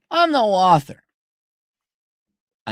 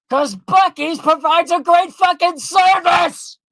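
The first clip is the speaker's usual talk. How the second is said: high, loud, slow shout; shouted praise of Buc-ee's service; ranting shout; 10 semitones higher, 7 dB louder, slower than usual. Real, not acted. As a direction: hammer the point home in a rant.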